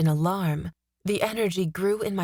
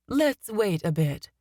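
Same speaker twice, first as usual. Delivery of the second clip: gentle suggestion; 3 semitones higher, slower than usual. A narrator's reading. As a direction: ease her down slowly. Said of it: "she suggested".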